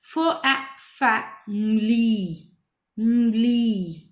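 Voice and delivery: फैमिली broken slowly into separate sounds, drawn out; slow phonics blending of फैमिली. Shown as a demonstration. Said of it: "बच्चे को break करना सिखाएंगे".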